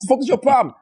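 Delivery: high-pitched, retold outrage; mock indignant. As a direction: act affronted by a stranger's thanks. Acting out offence at a thank-you.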